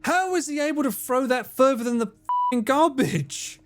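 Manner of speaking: high-pitched, baffled protest; exasperated, voice jumping up; incredulous outburst. Real, not acted. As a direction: protest it high, in total disbelief. Outraged at an enemy's throw.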